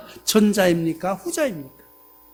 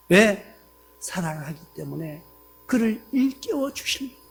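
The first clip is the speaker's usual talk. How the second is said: ordinary talk, nothing marked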